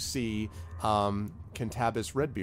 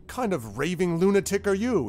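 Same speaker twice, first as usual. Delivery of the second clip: higher-pitched disbelieving question; incredulous guard; 8 semitones higher, 4 dB louder than usual. Acted. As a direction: challenge the speaker in raised-pitch disbelief. Guard reacts to newcomer's speech.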